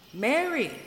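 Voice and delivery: high, loud shocked outburst; a startled, appalled exclamation; appalled cry. A narrator's reading. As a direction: recoil at the very idea. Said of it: "cried Snow Knight, appalled".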